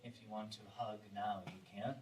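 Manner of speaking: hushed guidance with long pauses between phrases; guided meditation. Real, not acted. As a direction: guide them gently, barely above a murmur. Leads partners through meditation.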